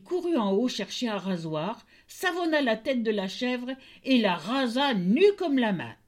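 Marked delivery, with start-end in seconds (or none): none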